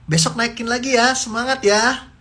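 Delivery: cheering on. Acted. Parent cheering child on.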